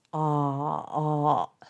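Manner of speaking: long, low "あー" between Japanese o and a; drawn-out open "あー" from the throat; dropped-jaw open o. Shown as a demonstration. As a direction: drop the jaw from an o shape and hold "あー" slowly. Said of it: "もっとぐっと顎を下げます".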